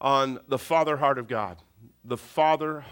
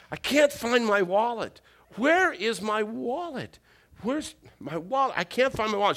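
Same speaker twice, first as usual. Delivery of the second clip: mildly exasperated, higher-pitched muttering to himself; a put-upon dad's higher-pitched complaint; exasperated searching; 7 semitones higher than usual. Retold or imitated. Father searching for lost wallet.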